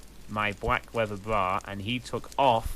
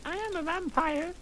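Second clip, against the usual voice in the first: put-on much higher, sugary girly voice; coy confession. Ebony confessing to Vampire in a high girly voice. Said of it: "I confessed".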